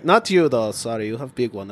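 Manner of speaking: a lightly put-on foreign accent in broken English; slipped into an accent. Host drifts into a foreign accent joking with a guest, mild. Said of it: "I don't know why it went to accent".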